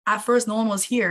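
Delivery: ordinary talk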